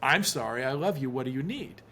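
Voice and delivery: soft, tender reassurance; calm apology. Retold or imitated. Husband answering his upset wife instead of reacting, calm and soft. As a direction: say it gently without taking offence. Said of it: "if I don't take that personally".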